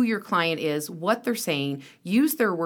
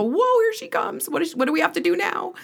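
mock alarm; 11 semitones higher than usual. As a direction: act out coworkers bracing. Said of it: "people go".

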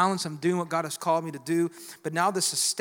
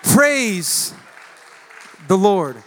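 drawn-out praise; 9 dB louder, much slower than usual. Acted. Preacher leads praise.

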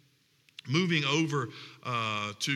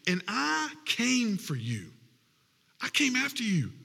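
rising sermon appeal; 6 semitones higher than usual. Acted. Pastor urging the congregation to remember Egypt, rising preaching. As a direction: pitch climbing higher, preaching with mounting fervor.

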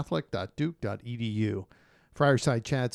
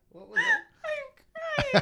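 laughing; much slower than usual. Real, not acted. Laughing at blooper.

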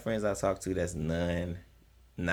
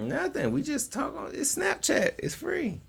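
high defensive; slower than usual. Real, not acted. Defending himself against paying strippers.

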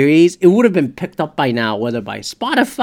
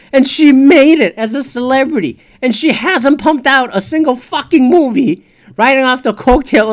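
louder, higher ranting tirade; heated rant; 6 semitones higher, 5 dB louder than usual. Real, not acted. Ranting to listeners about Jada, loud and heated. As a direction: let the outrage climb and stay up.

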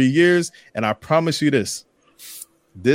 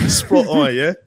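mock British accent on a quick check-in; terrible British accent; 2 semitones higher than usual. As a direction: play at being British, badly. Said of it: "for that terrible accent".

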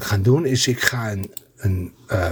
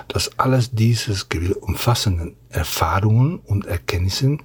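asmr whisper; 4 semitones lower than usual. Acted. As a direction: whisper it like a bedtime story. ASMR reading German manual.

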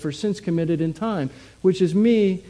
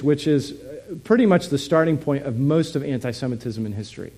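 ordinary talk, nothing marked

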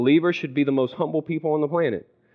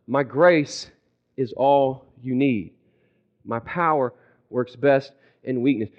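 lower, slow, patient voice; calm divine reply; 5 semitones lower, 3 dB quieter than usual. Acted. God giving the same third answer, calm and patient. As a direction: repeat it evenly, with gentle spacing. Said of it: "His response the third time to you is the same".

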